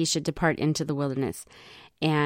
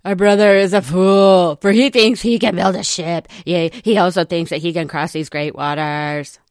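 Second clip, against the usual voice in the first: loud scornful brothers' voice; mocking complaining brothers; 6 dB louder than usual. Teacher voices Nephi's brothers mocking him, loud and scornful. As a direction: sneer it at your brother. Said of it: "That's what his brothers sounded like".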